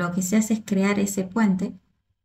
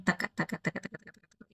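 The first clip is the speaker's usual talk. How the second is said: softer and softer taca taca fading to a whisper; rhythmic taca taca dying into breath; beat onomatopoeia; 3 semitones lower, 11 dB quieter, much faster than usual. Shown as a demonstration. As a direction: tap out the rhythm with your voice, fading away. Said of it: "está marcando el tempo real, que es".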